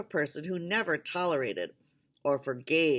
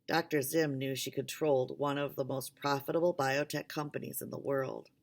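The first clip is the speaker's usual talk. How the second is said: ordinary delivery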